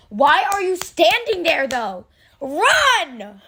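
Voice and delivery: high, drawn-out mock yell at fictional characters; exasperated yelling. Fan urging frozen characters to flee, exasperated high yell. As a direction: plead loudly, pitch jumping up.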